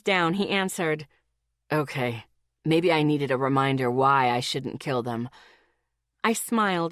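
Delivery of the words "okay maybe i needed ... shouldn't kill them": lower, fed-up male voice; Dylan's voice. A narrator's reading. Boyfriend complaining about his trainees, lower and fed up. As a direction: play the exasperated boyfriend, voice pitched lower.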